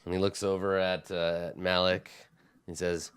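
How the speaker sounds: normal talking voice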